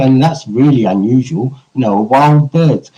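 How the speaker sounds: ordinary talk, nothing marked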